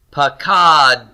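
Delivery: "pakad" slowly sounded with released t after d; unvowelled final cluster. Shown as a demonstration. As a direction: say "pakad" as pa-KADT, no vowel at the end. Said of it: "we're not gonna vocalize either".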